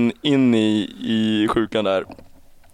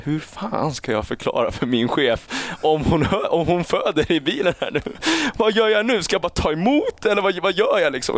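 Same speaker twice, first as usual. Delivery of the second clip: rising, higher panicky inner voice; panicked thought; 7 semitones higher than usual. Retold or imitated. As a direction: spiral up into panic. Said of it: "jag hinner tänka tanken".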